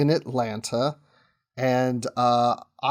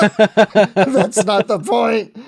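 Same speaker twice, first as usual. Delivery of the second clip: slow laughing protest, higher and louder; 6 semitones higher, 5 dB louder, much slower than usual. Real, not acted. Actor joking with host about his drama role, laughing. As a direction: laugh through it at the idea of audience laughing.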